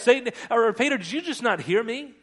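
loud, high-pitched disbelieving question; acted exasperation at someone who won't listen; exasperated rebuke. Acts Jesus rebuking Peter.

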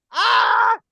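loud, high exasperated yell; yelling in frustration at the teasing; frustrated shout. Real, not acted. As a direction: let out a loud cry of frustration. Exasperated outburst.

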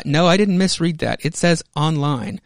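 ordinary delivery, nothing marked

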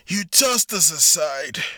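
slow furious shout. Acted. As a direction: spit it out loud and slow. Revenge speech over beaten enemy.

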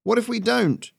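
high-pitched shouted challenge. A narrator's reading. Worker challenging the rebel leader, high shout. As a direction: call it out high from the crowd. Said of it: "someone shouted".

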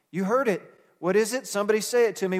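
rousing call to the pews; call and response. Real, not acted. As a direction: coax them to answer. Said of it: "calls for a response".

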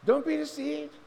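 high, loud, with stress on "be"; impassioned appeal. Shown as a demonstration. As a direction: pastor warns the congregation about deception, a loud impassioned plea. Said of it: "that's a passionate appeal".

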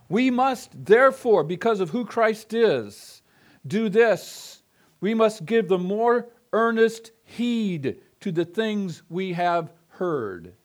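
"We must" pressed hard, then slow and measured through "earnest heed"; emphatic reading of the verse; forceful scripture quote. Shown as a demonstration. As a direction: read it as a solemn command. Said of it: "it has the force of".

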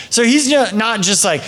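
ordinary talk, nothing marked

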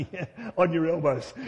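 real laugh in the voice. Real, not acted. Preacher joking about clapping with elbows, laughing.

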